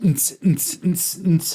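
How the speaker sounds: spat-out "nts nts" dance beat; party music imitated by mouth; imitated club beat. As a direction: mouth a thumping club rhythm.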